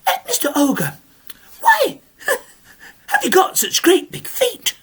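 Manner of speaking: high, fussy scolding granny; indignant old lady. Acted. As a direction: tick him off like a prim old lady. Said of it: "The little old lady says".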